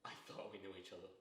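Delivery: low, soft, wounded; quietly hurt. Acted. Man who thought he knew his friend, quietly hurt. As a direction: let it sink to a low, soft admission.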